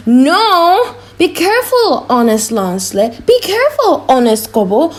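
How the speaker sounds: loud, high anxious warning; pleading conscience. Acted. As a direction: urgently hold him back. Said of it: "But my conscience says".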